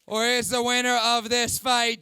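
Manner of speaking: ordinary delivery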